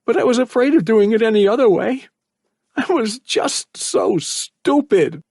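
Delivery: put-on boy confessing his regrets while crying; voice cracking. A narrator's reading. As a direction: confess through tears, voice breaking. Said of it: "his voice cracking".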